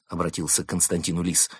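plain reading voice

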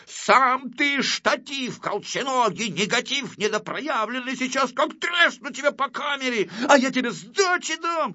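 high-pitched heated bickering; squabbling threats. Acted. Two little artists threaten to hit each other, squabbling. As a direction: trade insults in a high, indignant voice.

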